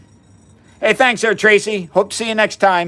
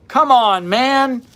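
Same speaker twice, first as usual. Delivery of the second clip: higher and louder, fed up; exasperated come on; 8 semitones higher, 4 dB louder, much slower than usual. Real, not acted. Host scoffing at methylene blue health fads, exasperated. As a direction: scoff at the nonsense.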